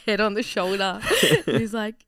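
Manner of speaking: usual speaking voice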